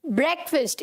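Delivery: ब्रेकफास्ट as "BREK-fust"; short e and reduced second vowel in ब्रेकफास्ट; correct pronunciation. Shown as a demonstration. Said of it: "it is the correct pronunciation".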